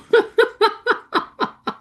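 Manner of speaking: a long, high laugh that falls away; high laughter. Real, not acted. Teacher laughing about her voice in their heads, high and long.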